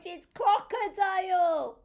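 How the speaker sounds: high, loud announcement; excited reveal. Acted. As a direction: burst out with the animal's name. Revealing the next animal.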